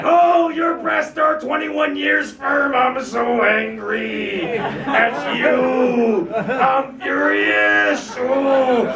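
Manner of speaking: slow mock-angry voice that keeps sounding grateful; failed angry voice. Comic to young girlfriend, trying mock anger but sounding aroused. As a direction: try to sound furious but come out pleased. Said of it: "hard to say thank you in an angry way".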